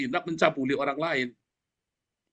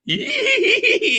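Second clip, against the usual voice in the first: high drawn-out "hihihi" squeal; gleeful giggle; 5 dB louder, much slower than usual. Acted. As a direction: giggle in a long, high-pitched burst of mock delight. Acts out the man's eager glee. (tawa cekikikan girang melengking)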